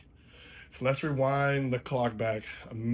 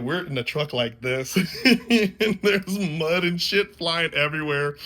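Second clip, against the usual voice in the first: laughing loudly, higher and slower; 7 semitones higher, 7 dB louder than usual. Real, not acted. Recalling the mud ride, laughing out loud. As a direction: laugh through the memory.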